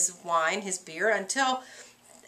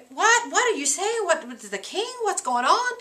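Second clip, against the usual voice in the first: high, alarmed questions; mock shocked Macbeth; 9 semitones higher, 4 dB louder than usual. Teacher voicing Macbeth faking surprise at the news. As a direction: act startled, pitch jumping up.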